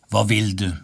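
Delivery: ordinary delivery, nothing marked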